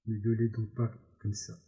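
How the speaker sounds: plain reading voice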